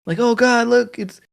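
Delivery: high-pitched imitation of gushing moviegoers; mock fan excitement. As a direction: gush like a starstruck audience member. Voicing excited audience at movie.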